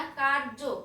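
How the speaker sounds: কার্য with a clear r before j; r sounded. Shown as a demonstration. Teacher repeating the correct form, r sounded in কার্য. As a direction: pronounce কার্য as "kar-jo". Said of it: "এখানে র উচ্চারণ হবে".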